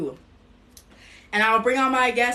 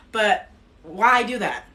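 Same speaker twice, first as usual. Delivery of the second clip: ordinary talk, nothing marked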